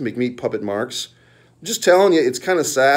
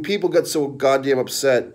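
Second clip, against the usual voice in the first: normal talking voice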